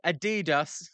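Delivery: "Adidas" said as ah-TEE-das, mock-foreign; a hint of mocking the other pronunciation; imitating a chat pronunciation. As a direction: lightly mimic how the viewer says "Adidas". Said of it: "As, uh, Zim Zim says".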